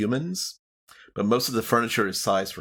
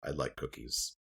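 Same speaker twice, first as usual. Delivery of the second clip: captain; 6 semitones lower, 11 dB quieter than usual. Acted. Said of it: "The captain is like".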